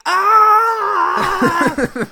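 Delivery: high loud mock scream. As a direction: scream as if the traffic noise hits. Imitated traffic scream.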